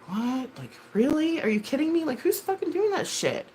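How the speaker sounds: high-pitched disbelieving questions; retold incredulity. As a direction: pitch it way up, can't believe it. Acts out reaction to CPS news.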